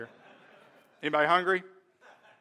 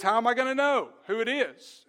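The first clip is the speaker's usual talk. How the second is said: a mildly acted puzzled question, higher pitch; questioning servant; 5 semitones higher, 4 dB louder than usual. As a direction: play the servant at a loss, lightly.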